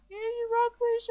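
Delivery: high, loud fan shout; excited cheer. Real, not acted. Host calling out to the singer they love, excited cheer.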